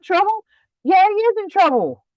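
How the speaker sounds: high-pitched, indignant retort; indignant disbelief. Real, not acted. Woman insisting Alan is in trouble, indignant. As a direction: push back in a high, incredulous voice.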